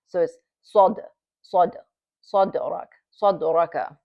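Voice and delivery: صدرك with a light bounce on the dal; light qalqala. Shown as a demonstration. Teacher demonstrating light qalqala to students. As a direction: tap the د in صدرك lightly and move on. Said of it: "it bounces".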